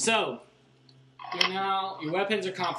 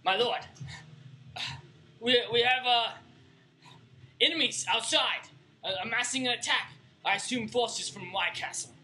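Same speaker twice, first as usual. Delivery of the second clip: urgent messenger; 8 semitones higher than usual. Acted. Messenger reports enemy attack.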